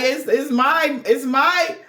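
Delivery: high, pleading mock voice, dragged slow; a lightly hammed-up impatient lover; whiny soulmate demand. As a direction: imitate a needy lover demanding it now. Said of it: "He's like".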